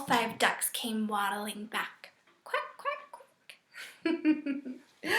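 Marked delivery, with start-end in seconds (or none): 2.4-5.1 duck quacks. Pretend. Teacher voicing duck sounds.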